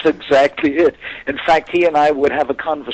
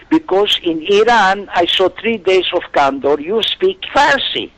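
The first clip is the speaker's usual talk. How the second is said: higher-pitched imitation of an Iranian accent; heavy Iranian accent; 6 semitones higher, 3 dB louder than usual. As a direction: play an insistent Iranian fan with a thick accent. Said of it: "in a heavy accent".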